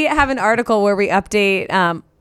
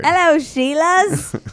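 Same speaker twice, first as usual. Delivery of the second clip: high, slow hammed-up Australian drawl; bad Australian accent; 7 semitones higher, slower than usual. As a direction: mimic a terrible Australian accent. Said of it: "the worst Australian, uh, interpreter".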